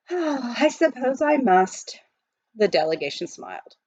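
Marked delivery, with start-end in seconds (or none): 0.1-2.0 slow, sighing resignation; mock-reluctant sigh; sighing reluctance. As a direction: give in with a weary sigh.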